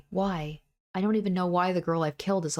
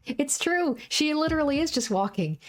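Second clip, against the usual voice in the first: much higher, eager aside; excited insistence; 9 semitones higher than usual. Real, not acted. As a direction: insist it's really true, bursting out.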